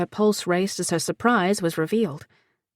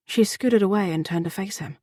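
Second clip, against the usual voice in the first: ordinary delivery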